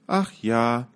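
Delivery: put-on a sad girl sighing yes. A narrator's reading. Girl answers the prince with sorrow. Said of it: "sagte das Mädchen mit trauriger Stimme".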